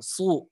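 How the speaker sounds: "su" with a thick emphatic s; shad dhammah tebal. Shown as a demonstration. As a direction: raise the tongue back on "su". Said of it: "gitu, tebal". ("su" tebal, pangkal lidah naik)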